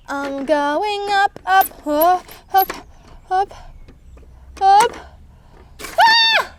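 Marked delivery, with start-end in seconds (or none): 5.8-6.5 high thrilled scream as it drops; playful scream dropping down; ride scream. Pretend. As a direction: shriek on the fall. Drop tower scream.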